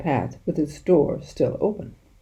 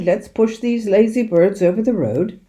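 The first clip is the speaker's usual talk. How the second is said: hearty, higher call to action; cried out; 5 semitones higher, 4 dB louder than usual. A narrator's reading. Sergeant urging the men to push the birds, hearty call. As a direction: urge them on cheerfully. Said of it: "he cried".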